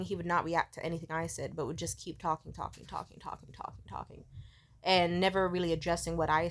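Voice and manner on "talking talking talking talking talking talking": repetition fading down into a whisper; trailing-off mockery of endless chatter; droning on endlessly. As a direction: let the repeated word dwindle to unvoiced breath. Mimics endless talk, fading away.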